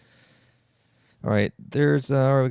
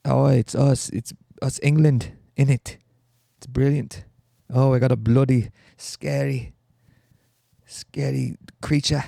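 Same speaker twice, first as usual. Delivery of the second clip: hammed-up English accent, veering Australian; exaggerated English lad accent; bad English accent; 3 semitones higher, 3 dB louder than usual. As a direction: do a badly faked English accent, raised in pitch. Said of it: "I really can't do accents".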